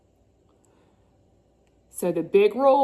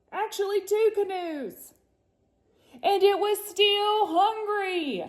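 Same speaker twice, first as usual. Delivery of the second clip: dramatic build; 7 semitones higher than usual. A narrator's reading. Dramatic Kraken description.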